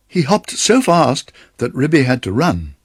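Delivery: plain reading voice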